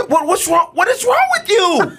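exasperated high-pitched demand; intense. Retold or imitated. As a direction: rant at your son, voice way up. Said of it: "he cries at the intensity of".

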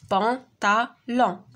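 بونطالون in three detached syllables. Shown as a demonstration. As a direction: separate each syllable of بونطالون for young pupils. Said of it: "كنسمعوها في لا بروميير سيلاب". (بونطالون مقطعة سيلاب بسيلاب)

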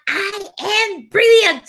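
high, slow affirmation shout; 力強い宣言. Shown as a demonstration. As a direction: proclaim it high and drawn-out. Chanting affirmation to a viewer. (高く伸ばして力強く宣言)

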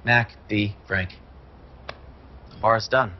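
solemn mock farewell to the bar. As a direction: bid a grand tragic farewell. Mournful farewell.

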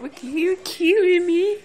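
high, loud cooing. Real, not acted. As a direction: squeal it up high. Cooing at the dog.

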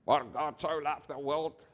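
comic parrot squawking out scripture; put-on talking-bird voice. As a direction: mimic a parrot reciting by rote. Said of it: "like a parrot".

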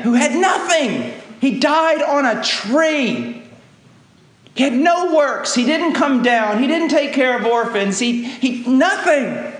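loud, high impassioned preaching with a laugh at the start; fervent pulpit climax; preaching at full force. Acted. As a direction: hammer home that he had nothing.

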